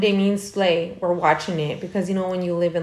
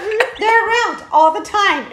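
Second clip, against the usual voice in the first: mock insistence; 11 semitones higher, slower than usual. Acting out her insistent reply.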